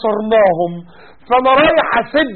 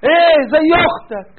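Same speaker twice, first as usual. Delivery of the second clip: mock disbelief; 6 semitones higher, 4 dB louder, slower than usual. Mocking the man lowering his gaze.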